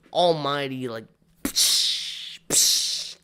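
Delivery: normal talking voice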